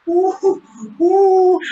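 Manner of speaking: high, loud "ooh" hoots; excited whooping oohs. Real, not acted. Fan watching the wrestler's comeback, excited high oohs. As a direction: hoot with rising excitement at the action.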